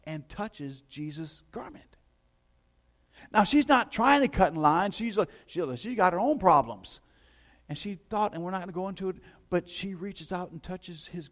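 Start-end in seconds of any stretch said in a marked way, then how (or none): 3.3-7.0 louder and higher, pressing forward; preaching at full force. Acted. Pastor preaches Jairus's plea to the congregation, loud and high. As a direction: drive the scene home to the pews.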